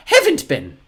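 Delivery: very high, loud prim correction; correcting. A narrator's reading. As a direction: butt in to fix the grammar. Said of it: "Aunt Josephine corrected".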